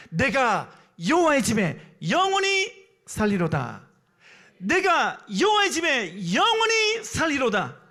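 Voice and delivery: slow, high, ringing declamation. Real, not acted. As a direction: proclaim the verse loudly, stretching each phrase. Preacher declaims the verse as conclusion. (길게 끌며 높고 힘차게 선포)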